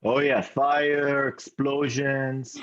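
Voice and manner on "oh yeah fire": ordinary delivery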